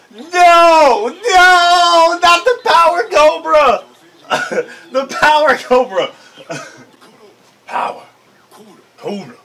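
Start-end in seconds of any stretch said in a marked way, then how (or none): 0.1-6.1 high, loud hammed-up wail; mock despairing shout. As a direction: cry out in fake horror.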